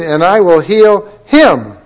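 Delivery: slow and loud, "him" punched high after a pause; stress on him. Shown as a demonstration. Preacher shows congregation the singular word, slow loud stress on "him". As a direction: hold back, then hit "him". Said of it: "I want you to notice the word".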